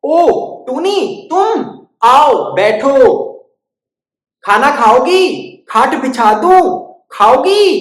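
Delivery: high, slow sing-song welcome; welcoming granny cow. Acted. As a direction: invite her in slowly.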